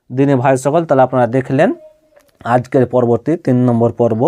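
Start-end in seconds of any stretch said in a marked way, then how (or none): none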